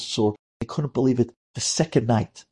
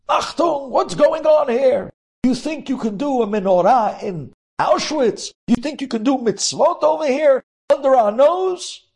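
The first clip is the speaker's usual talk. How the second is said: high, loud barked Nazi shouts; soldiers yelling; 13 semitones higher, 4 dB louder than usual. A narrator's reading. Nazi soldiers storm the barracks, screaming furiously. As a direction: scream it down at the prisoners. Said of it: "They start yelling and screaming".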